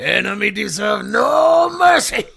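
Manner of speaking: put-on high, loud accented bark; hammed-up accented shout; shouted movie line. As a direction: yell it like the sensei. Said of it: "Mercy is for the weak".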